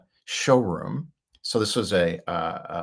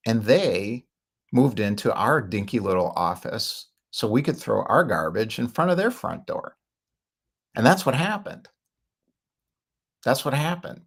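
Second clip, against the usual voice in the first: ordinary delivery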